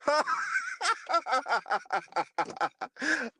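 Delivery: big laugh tumbling down from a high start; helpless belly laugh at own joke; long cackling laugh. Real, not acted. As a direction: crack up and keep on laughing.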